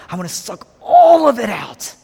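loud, high surge on "all"; fierce resolve. Acted. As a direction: burst out with total determination. Jesus taking all suffering.

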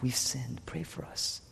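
hushed, pleading whisper; whispered plea. Retold or imitated. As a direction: whisper it like a desperate prayer. People begging God, voiced.

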